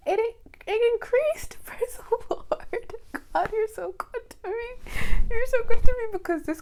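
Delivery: high giggly praise, half laughing; delighted laughing praise; joyful praise. Retold or imitated. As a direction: gush thanks to God. Retold joy at price increase.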